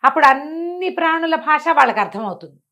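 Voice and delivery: usual speaking voice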